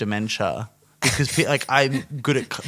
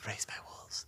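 whisper; 22 dB quieter than usual. Acted. Spooky aside.

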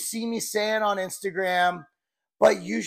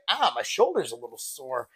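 lower, softer mock grumble about a sore shoulder; mock minor complaint; 7 semitones lower, 5 dB quieter than usual. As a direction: toss it off as a small gripe, quieter. Shrugging off minor aches.

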